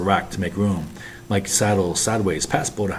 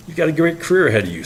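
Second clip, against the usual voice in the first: forced smile; 9 semitones higher, 5 dB louder, faster than usual. Acted. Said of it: "says Mike with a forced smile".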